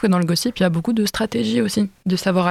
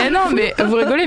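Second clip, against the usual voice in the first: laughing; 5 semitones higher than usual. Real, not acted. Laughing while protesting.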